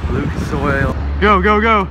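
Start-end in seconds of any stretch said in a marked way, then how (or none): none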